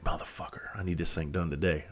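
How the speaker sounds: hushed, breathy mutter under the breath; muttered curse. Retold or imitated. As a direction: mutter it to yourself, fed up. Frustrated muttering to himself.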